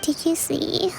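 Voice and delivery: drowsy murmured whisper; barely awake, just breathe it; sleep talking. Acted. Kokomi mumbling in her sleep.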